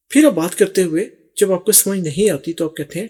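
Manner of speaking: ordinary delivery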